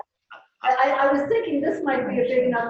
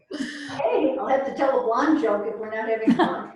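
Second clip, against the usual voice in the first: usual speaking voice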